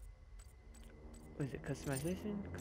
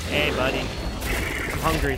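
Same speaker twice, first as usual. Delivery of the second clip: slow, low hungry demon voice; menacing demon growl asking for food; 3 semitones lower, 13 dB louder, slower than usual. Pretend. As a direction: drag out the hunger like a monster. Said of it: "I'm a hungry demon".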